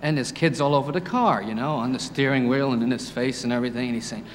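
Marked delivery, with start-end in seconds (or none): none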